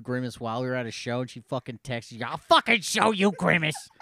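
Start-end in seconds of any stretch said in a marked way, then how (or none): 2.2-3.9 loud, raised-pitch mock threat; mock fury. Imitating mad mother texting after Googling Grimace, loud mock fury. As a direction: belt out the threat in imitated outrage. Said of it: "She was so fucking mad".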